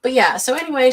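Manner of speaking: ordinary talk, nothing marked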